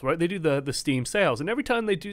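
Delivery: ordinary talk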